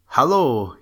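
cried out. A narrator's reading. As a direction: call out to the man by the lake. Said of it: "he cried to him".

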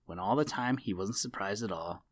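ordinary delivery

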